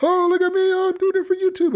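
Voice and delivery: high-pitched mocking stunt-man yelp; mock YouTuber. Podcaster parodies YouTuber on fire, high mock panic.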